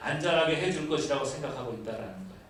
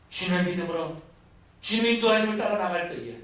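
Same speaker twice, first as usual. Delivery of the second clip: building, fervent sermon climax; fiery preaching; 6 semitones higher, 4 dB louder than usual. Acted. Pastor denounces the grumbling Israelites, fervent preaching. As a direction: drive the point home to the congregation.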